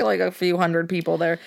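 normal talking voice

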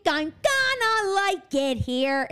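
high, slow sing-song orphan; mock Annie. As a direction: drag it out like a sugary child. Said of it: "and she".

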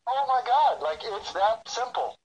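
sudden delighted discovery, slowing on the last words; eureka moment. Retold or imitated. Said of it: "it was like a eureka".

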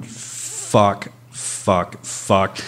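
low, soft swearing to himself; inner cursing. Retold or imitated. Injured rider cursing in his mind, quiet and low. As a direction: curse under your breath, spaced out. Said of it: "in my mind, I'm just going".

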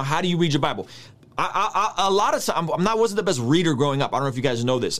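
ordinary talk

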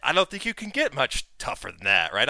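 high, loud, pumped self-talk; hyped up. Retold or imitated. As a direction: psych yourself up. Retold inner hype.

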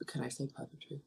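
softly seek permission under your breath; hushed aside. Real, not acted. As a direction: ask quietly, checking if it's allowed. Asks permission to reveal a spoiler.

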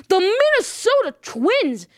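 high, drawn-out triumphant announcement; dramatic reveal. Real, not acted. Host shocks viewers with his World Series pick, dramatic reveal. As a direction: stretch out the big surprise pick.

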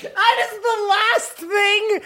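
high cracking shout of disbelief; incredulous shriek. Real, not acted. Shocked outburst at silly idea.

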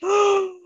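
shocked high gasp of horror; sharp gasp. Retold or imitated. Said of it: "And you're like".

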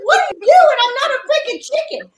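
high wailing, fast at the end; mock crying daughter. Daughter wailing at mom that she's no chicken. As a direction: wail it through tears, half joking. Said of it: "She goes".

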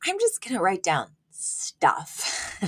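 slow, stretched-out imitation of a doctor; slow, deliberate comic delivery; deadpan retold doctor. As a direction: draw the words out, lingering on "stuff". Imitating doctor's comically vague line.

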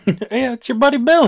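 mock-chummy, high, loud greeting; Billy. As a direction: play a falsely friendly pal calling up. Joking as falsely friendly Billy.

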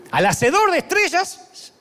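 preached slowly, voice soaring. Acted. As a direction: lift it like a revelation. Dramatic preaching build.

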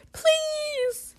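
very high, squeaky whine; mock pleading. Player begging at the phone, high squeaky mock whine. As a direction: squeak out a drawn, hammed-up plea. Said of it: "I'm just on my phone like".

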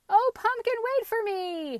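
high, loud pleading wail; crying out. A narrator's reading. Captive potato to his leader, wailing cry. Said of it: "he cried".

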